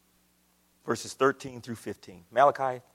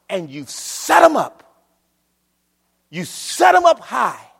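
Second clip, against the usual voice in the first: loud, higher proclaiming God's rebuke; preaching at full force; 9 semitones higher, 12 dB louder than usual. Acted. Preacher thundering God's rebuke, loud and higher. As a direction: thunder God's words over the church.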